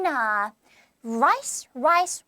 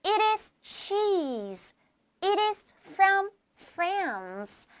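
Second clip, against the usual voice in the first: slow model reading with careful words; slow, precise reading; 6 semitones higher, much slower than usual. Shown as a demonstration. Teacher models textbook lines for students, slowly and precisely. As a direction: read it out slowly and exactly for learners. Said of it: "천천히 정확하게 읽어주면 돼요".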